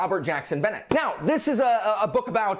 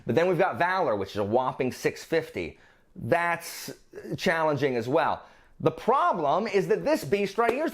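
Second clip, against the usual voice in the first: ordinary talk, nothing marked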